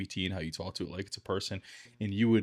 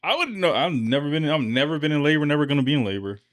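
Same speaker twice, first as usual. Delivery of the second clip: excited; 6 semitones higher, 5 dB louder than usual. Real, not acted. Protesting loudly, high.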